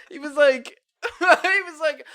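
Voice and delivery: high, loud, laughing through the words; helpless laugh breaking into the story; real laughing. Real, not acted. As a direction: try to tell it while cracking up. Cracking up while retelling.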